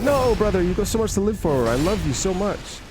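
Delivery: mock a doting buddy pleading; gushing comfort. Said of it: "it'll be like".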